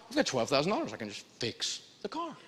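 imitated dawning thought, dropping to very soft; quiet realization. Woman realizes she can pay for repairs, hushed. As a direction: trail down low and hushed, as if thinking it.

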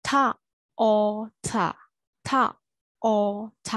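"taught" split into ထ, အော်, ထ with gaps; separate, held sounds ထ, အော်, ထ for "taught"; sound-by-sound breakdown. Shown as a demonstration. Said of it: "အတန်အတွက်ကိုကြည့်မယ်".

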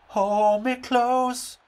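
slow, high, dramatic plea; mock plea. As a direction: stretch it out like a ballad. Pleading to the penalty taker.

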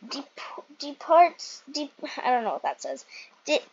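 usual speaking voice

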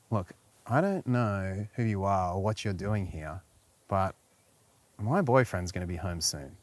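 low, soft, sultry stranger voice; sexy stranger. Pretend. Wife role-playing a stranger who must hide him, low and soft. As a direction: purr it low like a tempting stranger. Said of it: "So you get your sexy on a little bit".

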